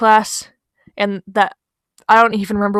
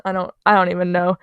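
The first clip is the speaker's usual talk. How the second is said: usual speaking voice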